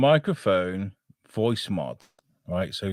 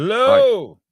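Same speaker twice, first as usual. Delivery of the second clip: loud hello; 11 semitones higher, 9 dB louder than usual. Acted. Testing voice changer.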